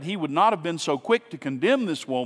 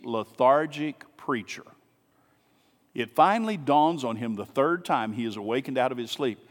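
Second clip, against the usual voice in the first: ordinary delivery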